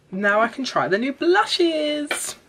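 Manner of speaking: high, drawn-out sing-out of excitement; excited. Real, not acted. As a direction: sing it out slowly, pitch climbing high. Keen to try new blushes.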